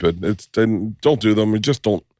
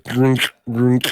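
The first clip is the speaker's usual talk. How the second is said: higher, put-on crackling noise; imitated microphone glitches; 4 semitones higher than usual. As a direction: mimic a faulty microphone cutting in. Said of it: "You'll have microphone problems".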